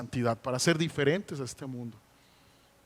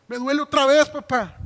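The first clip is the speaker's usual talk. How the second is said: put-on higher, louder childish complaint; little daughter whining; 9 semitones higher, 8 dB louder than usual. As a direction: whine like a small girl hurting again. Father voices his little daughter. (niña quejándose, más aguda y fuerte)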